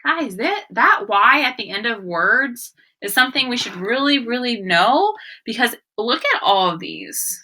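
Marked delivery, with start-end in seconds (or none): none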